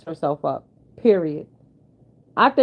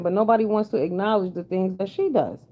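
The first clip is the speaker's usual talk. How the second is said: ordinary talk, nothing marked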